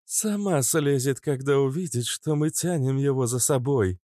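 high-pitched woman's grumble; grumbling. A narrator's reading. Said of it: "буркнула Кобра".

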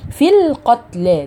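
في joined straight to اللام, slow; slow careful demo. Shown as a demonstration. Teacher showing the dropped long vowel. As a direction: glide في into القتلى with no ي. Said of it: "دخلت على طول على اللام".

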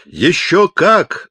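high, slow, drawn-out shout. A narrator's reading. Deaf chaplain confirms the megaphone helps, shouting. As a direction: holler it with full conviction. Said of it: "заорал капеллан".

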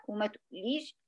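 normal talking voice

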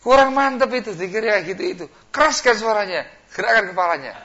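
high, loud coaxing; devil egging on. Pretend. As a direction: whip him up.

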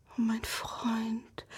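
slow, very soft whisper; whispering good news to husband. Acted. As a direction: barely breathe the words to him. Wife pleads with husband.

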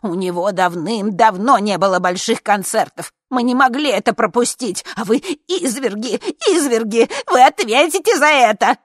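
high, loud, mounting outrage; impassioned old woman's indignant shouting; crying out. A narrator's reading. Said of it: "кричала одна из старушек".

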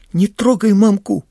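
put-on loud, high cry of a boy defending his mother; protective shout. A narrator's reading. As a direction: shout it up high to stop him. (громкий высокий крик в защиту)

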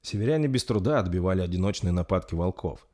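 usual speaking voice

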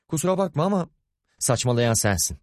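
tiz perdeden sitemli çıkış; eşine sesini yükselterek karşılık ver; sesini yükselterek çıkışma. Acted. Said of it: "Sesini yükseltme". (sinirle sesini yükselten kadın)

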